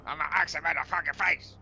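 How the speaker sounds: battle shout. Acted. Player declares a furious attack.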